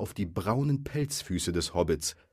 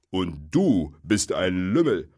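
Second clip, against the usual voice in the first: slow, louder troll insult; troll's retort; 6 dB louder, slower than usual. A narrator's reading. Bill insulting Bert back, slow heavy retort. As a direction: drag the insult out heavily.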